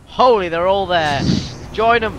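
excited yelling. Real, not acted. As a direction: yell it mid-firefight. Battle excitement.

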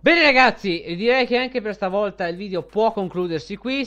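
loud, upbeat sign-off; youtuber outro. Acted. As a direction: wrap the video up cheerfully. Video outro.

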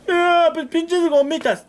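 high, scornful throwaway voice; mock dismissive. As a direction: brush off the little rubbers. Imitating dismissive careless person. (desprecio burlón y agudo)

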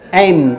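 "M" closing the lips into a nasal hum; closed-lip M. Shown as a demonstration. Teacher shows learners to close the mouth, humming "M". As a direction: end "M" with the mouth shut. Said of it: "嘴要合起来".

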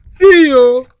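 loud, high-pitched tearful lament; crying. Real, not acted. Sister shows her mad brother's unfinished house, crying. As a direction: wail it through tears. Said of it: "I've been crying".

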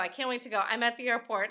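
usual speaking voice

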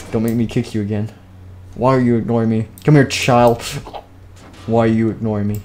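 usual speaking voice

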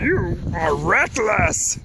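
slow creaky ancient sage; wise old master voice. Acted. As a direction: scold like a wise old hermit. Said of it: "Whose voice is that?".

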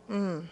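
soft, unwilling "mm"; quiet mock-reluctant murmur; reluctant hum. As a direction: hum a lazy refusal.

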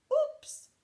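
high, loud oops; playful oops. A narrator's reading. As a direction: exclaim the slip. Sound word in story.

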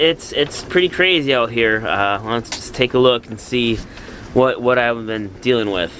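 ordinary delivery

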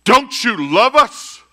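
high, loud demand; loud pleading cry. Acted. Preacher replaying the disciples' cry to Jesus, loud plea. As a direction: cry it out as an accusation.

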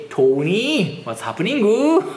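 put-on lilting Irish accent, last syllable stretched upward; thick Irish accent. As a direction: mimic an Irish girl's heavily accented call. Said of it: "アイリッシュの女の子ね". (アイルランド人の女の子のきつい訛りの真似)

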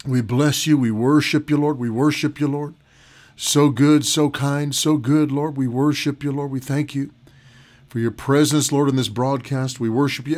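soft, low prayerful worship; hushed adoration to the Lord. Acted. Pastor praying softly to open the broadcast.

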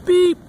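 high, loud short beep; perky high horn beep; little truck beep. A narrator's reading. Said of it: "said Blue".

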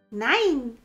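high, loud shouted no; child's shout. Acted. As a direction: shout it like a stubborn little boy. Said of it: "schrie Häwelmann".

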